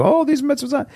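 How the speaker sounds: a hint of a sanctimonious preacher; mock piety. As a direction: parody a preachy hypocrite. Mocking preachy hypocrite.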